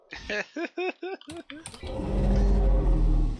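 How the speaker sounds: high, loud burst of laughing; real laughter. Real, not acted. Amused at being cursed out.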